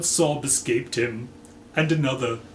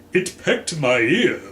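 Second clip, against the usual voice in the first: protesting; 3 semitones higher, 6 dB louder than usual. Acted. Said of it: "Mister Green protested".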